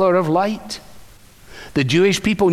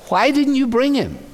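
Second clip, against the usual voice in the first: higher indignant question; indignant demand; 8 semitones higher than usual. Acted. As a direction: demand it, pitched up. Pharisees demanding of officers.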